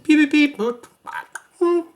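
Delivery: high, loud electronic bleeps; playful beep-boop sound effects; imitated computer beeps. Shown as a demonstration. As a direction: squeak out little alert noises. Imitating computer alert noises.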